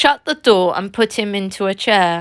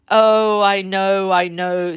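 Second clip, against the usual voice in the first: gloomy; slower than usual. Acted. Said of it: "said the Badger gloomily".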